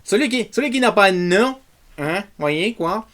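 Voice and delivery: high-pitched mock Québécois that slips toward south-west France; badly faked Québécois accent. Host botching an accent for the blooper reel, hammed-up Québécois. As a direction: have a clumsy go at Québécois French. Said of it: "j'aimerais faire l'accent québécois".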